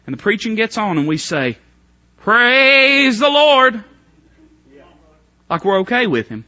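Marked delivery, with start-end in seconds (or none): 2.2-3.8 drawn-out, loud, showy "Praise the Lord"; mock pious praise. Preacher mocking hypocrites at church, drawn-out showy praise. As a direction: ham up a hollow churchgoer's praise.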